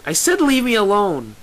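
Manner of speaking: high, loud angry shout; fed-up, forceful yell; shouting back. A narrator's reading. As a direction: shout it through a closed door. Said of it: "Lyra shouts back".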